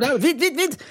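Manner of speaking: high, rushed hurry-up; host hurries the show along in a high voice; mock hurry. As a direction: pretend panic about time. Rushing, no time.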